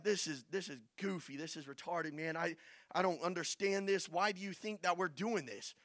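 low, soft, doubtful murmur; hushed, baffled grumble; grumbling among themselves. Acted. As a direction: complain privately to a fellow marcher. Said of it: "talking amongst themselves".

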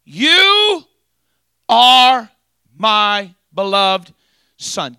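very high, very loud, slow booming call; voice from heaven. Shown as a demonstration. Preacher repeating heaven's voice for the congregation, booming. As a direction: soar up and hold every word. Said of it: "Just like that".

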